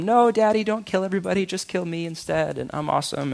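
put-on high, softening voice of a pleading kid; mock pleading son. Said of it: "and Jesus comes home".

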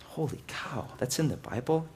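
very soft, stunned aside; hushed disbelief. Acted. Reader shocked that God kills people, hushed disbelief. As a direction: drop to a small, amazed voice.

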